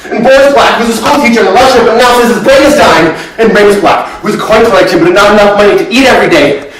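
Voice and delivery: high, worked-up, loud outpouring; agitated and insistent, pitched high; frantic recounting. Acted. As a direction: reel off the list, growing desperate. Acted frantic list of failed searches.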